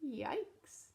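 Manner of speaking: ordinary delivery, nothing marked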